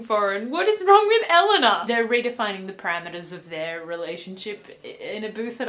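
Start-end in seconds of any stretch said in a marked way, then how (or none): 0.5-1.8 exasperated. Real, not acted. Exasperated about the name joke.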